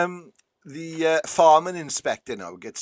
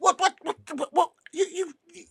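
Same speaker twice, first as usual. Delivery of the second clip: very high, spluttering astonishment; stunned stammering. Acted. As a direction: stammer as a farmer blown away, voice shooting up. Farmer blown away by talking dog.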